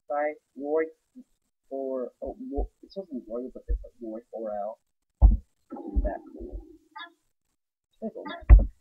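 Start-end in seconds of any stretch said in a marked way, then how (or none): none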